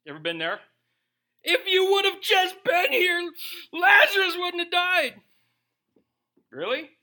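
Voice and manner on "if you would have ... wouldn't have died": high, loud wail of reproach; hammed-up angry sisters confronting someone; angry women. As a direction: lash out in a drawn-out, high-pitched accusation. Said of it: "You got two angry women".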